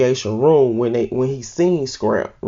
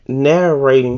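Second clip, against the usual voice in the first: ordinary talk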